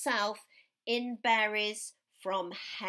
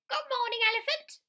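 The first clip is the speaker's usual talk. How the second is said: squeaky small animals, much higher and louder; 13 semitones higher, 3 dB louder, much faster than usual. A narrator's reading. The other animals greeting the disguised Elmer, high and squeaky. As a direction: chirp it like a tiny creature. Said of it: "And they said".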